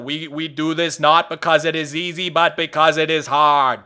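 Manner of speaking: measured speech in a mock Kennedy accent. Shown as a demonstration. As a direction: imitate a Kennedy speech accent, stretching the ending. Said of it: "the Kennedy's accent is".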